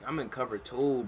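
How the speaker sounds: ordinary talk, nothing marked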